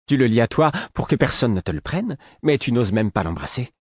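high, taunting jeer at a cousin; mocking. A narrator's reading. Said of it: "Narquoise, elle se gaussait de sa cousine".

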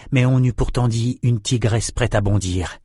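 ordinary delivery

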